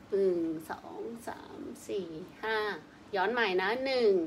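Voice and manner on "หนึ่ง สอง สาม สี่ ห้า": whispered count. Real, not acted. Counting massage reps softly.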